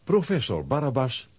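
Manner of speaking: ordinary talk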